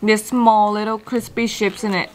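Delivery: speaking around a bite; talking while chewing. Real, not acted. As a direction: mouth partly full of food. Seen 2: She bit the burger at -7, seems still chewing while describing.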